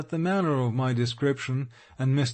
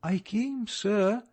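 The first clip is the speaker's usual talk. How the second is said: put-on higher, hesitant girl's voice; young ward answering her guardian, sweet and irresolute; sweet but irresolute; 6 semitones higher, slower than usual. A narrator's reading. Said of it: "in a sweet but irresolute accent".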